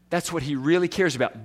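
usual speaking voice

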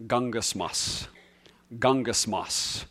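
gungasmos said slowly and softly, GUNG-as-mos; original Greek word. Shown as a demonstration. Teaching the Greek word to the congregation, slow and soft. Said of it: "the word in the original language".

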